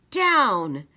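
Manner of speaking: usual speaking voice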